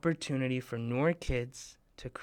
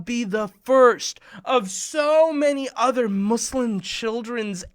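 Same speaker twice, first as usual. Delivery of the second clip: louder, higher, drawn-out grand announcement; excited build-up; 7 semitones higher, 8 dB louder, slower than usual. Real, not acted. Host pitching the big screen to kids, excitedly. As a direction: build to a big excited peak, then ease down.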